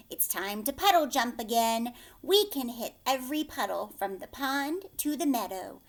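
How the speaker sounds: usual speaking voice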